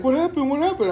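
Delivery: very high, loud urgent questioning; imitated alarmed girlfriend. Girlfriend asking about the toilet accident, imitated high-pitched alarm. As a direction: mimic a worried girlfriend way up high.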